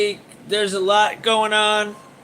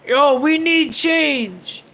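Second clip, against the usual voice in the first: mock whiny protester; 5 semitones higher, slower than usual. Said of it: "they want us ... to yell for".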